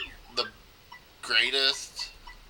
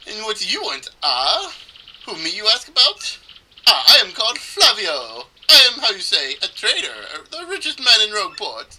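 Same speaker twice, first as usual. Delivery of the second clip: put-on higher-pitched, theatrical Italian accent; bad Italian accent; 9 semitones higher, 8 dB louder than usual. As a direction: boast like a hammy Italian trader. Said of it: "supposed to do an Italian accent".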